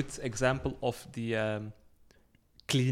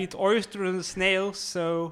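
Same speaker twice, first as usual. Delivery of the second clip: mocking American; 8 semitones higher, slower than usual. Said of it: "I do a French accent".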